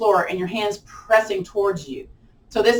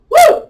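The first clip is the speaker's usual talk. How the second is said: very high, loud "woo"; sharp high whoop mid-exercise; 11 dB louder than usual. Real, not acted. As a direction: belt out a whoop of effort. Whoops while holding the pose.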